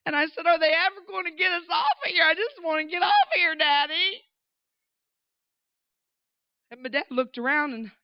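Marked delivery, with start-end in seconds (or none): 0.5-4.2 high, tearful mock plea to Daddy; whining little child. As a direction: imitate an upset kid begging, voice raised. Upset child pleading with dad.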